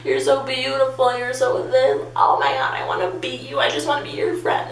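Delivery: high, whiny imitation of tearful gushing; mock drunk crying. Crying drunk friend gushing to her, mock-tearful and high. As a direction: gush it high like a weepy drunk. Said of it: "she's crying".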